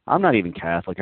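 ordinary delivery

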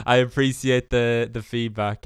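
laughing through the words; fighting back laughter; stifled giggles. Real, not acted. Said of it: "I'm trying not to laugh so hard".

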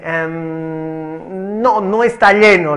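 drawn-out "em" breaking into an emphatic mock reply; reluctant students. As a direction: imitate wary pupils, stalling before they blurt it out. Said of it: "los alumnos no quieren responder".

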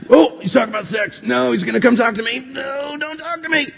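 very high, squeaky mock panic; mock dread. Preacher mimics nervous listeners avoiding his question, mock-panicked squeal. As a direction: squeal it, shrinking away to a tiny high plea.

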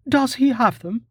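put-on high, eager young woman asking; can't wait for the answer, breathless hurry; high-pitched urgent question. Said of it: "she asked urgently".

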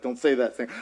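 God's line, dropped low and soft; quiet God voice. Retold or imitated. Preacher voicing God's soft warning, low and hushed. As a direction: let God answer softly, low in the voice.

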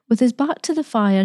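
usual speaking voice

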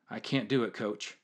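quiet, drained voice, barely getting it out; like you have nothing left after the sprint; worn out and breathless. Retold or imitated. Exhausted player gasping for breath.

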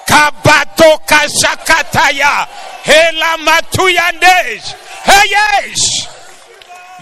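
high, loud, slow tongues; speaking in tongues. Real, not acted. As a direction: burst out in ecstatic tongues.

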